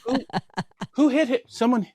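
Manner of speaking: high-pitched fake concern; feigned innocence. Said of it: "I'm keeping a straight face".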